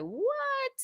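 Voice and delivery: a startled squeak of disbelief; shocked what. Retold or imitated. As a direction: caught off guard by the news. Shocked by the tow driver's news.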